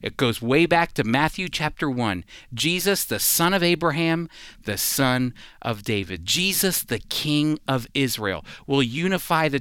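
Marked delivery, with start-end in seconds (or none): none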